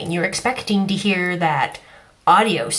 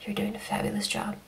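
tender praise; 11 dB quieter, faster than usual. Real, not acted. Tender praise to art director.

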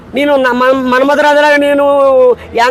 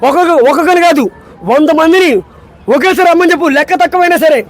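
Ram Charan dialogue; 4 semitones higher, faster than usual. Acted. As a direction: roar the challenge like a warrior hero. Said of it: "Ram Charan, Ram Charan".

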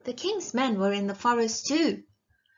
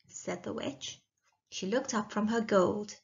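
plain reading voice

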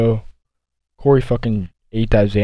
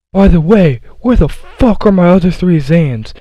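much higher and louder, slow and dazed; mock stoned outburst; 8 semitones higher, 7 dB louder, slower than usual. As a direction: yell it like a wasted guy who lost his pills. High dude demanding missing pills.

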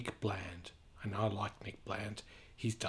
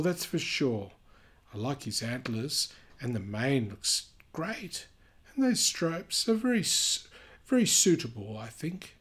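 lively storybook reading voice; animated read-aloud for kids; reading to children; 4 semitones higher, 7 dB louder than usual. A narrator's reading. Reading picture book aloud.

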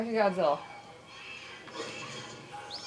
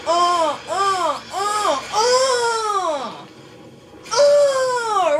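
groans climbing higher and louder; rising laughing groans; 9 semitones higher, 6 dB louder, much slower than usual. Real, not acted. Player wincing as his monster impales the foe. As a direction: build from laughing grunts to a cry.

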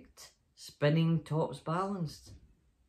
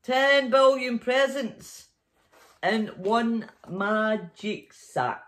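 higher, louder and slow; marvelling; 5 semitones higher, 8 dB louder, slower than usual. A narrator's reading. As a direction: savour the wonder slowly. Marveling narrator.

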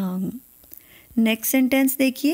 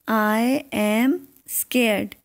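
very slow, each word drawn out; slow model sentence; much slower than usual. Shown as a demonstration. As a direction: spell it out slowly for learners. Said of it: "teacher models the English sentence". (बहुत धीरे, हर शब्द खींचकर)

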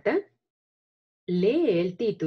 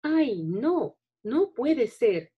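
higher, slow panicked cry; frightened robot; 5 semitones higher, slower than usual. Acted. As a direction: wail it like a scared robot. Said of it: "haz que tu voz también suene emocionante".

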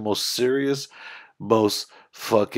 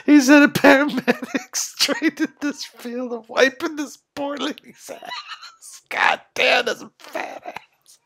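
high, wheezing words through helpless laughter; barely getting it out between laughs; laughing hard; 13 semitones higher than usual. Real, not acted. Viewer cracking up at the comedian's joke, laughing through words. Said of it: "that shit's killing me".

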